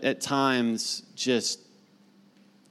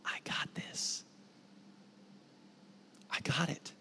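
soft unvoiced whisper of reassurance; the voice of Jesus whispering to a worried listener; 2 semitones higher, 17 dB quieter than usual. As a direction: breathe it gently, close and intimate. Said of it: "the voice that whispers to you".

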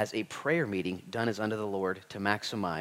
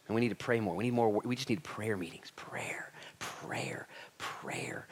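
whispered, fading prayer prayer prayer; mock pious whisper; 8 dB quieter than usual. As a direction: breathe it like a hushed devotee. Mocking the priestly types.

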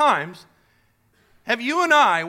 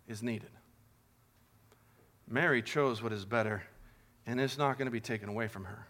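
gentle Jesus; 10 semitones lower, 8 dB quieter than usual. Acted. Jesus' gentle answer quoted.

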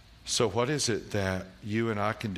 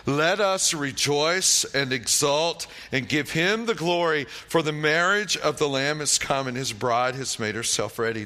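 exultant proclamation; 6 semitones higher, 6 dB louder than usual. Acted. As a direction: proclaim it like thunder from a crowd. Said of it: "crying out".

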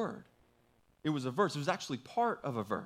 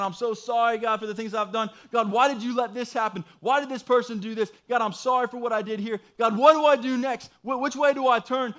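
high, loud, urgent crying-out to God; desperate pleading prayer; 8 semitones higher, 8 dB louder than usual. Retold or imitated. Retelling his prayer in the woods, high desperate pleading. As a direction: pour it all out, begging for an answer. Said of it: "I just started laying it out there".

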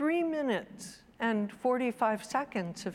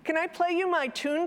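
put-on high, quick child voice; eager child; 9 semitones higher, 3 dB louder, faster than usual. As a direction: pester Mum like a keen child. Eager child asks mother.

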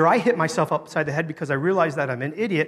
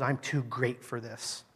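self-important inner thought; 3 semitones lower, 8 dB quieter than usual. Retold or imitated. Preacher voicing his first thought about the man, quietly self-important. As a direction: think it aloud, hushed and put-upon. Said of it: "My thought was".

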